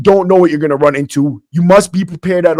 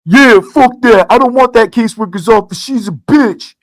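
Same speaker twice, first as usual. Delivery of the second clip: retold shout, pitched up; imitated yell; 4 semitones higher than usual. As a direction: call it out like a mocking yell across the room. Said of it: "Somebody yelled".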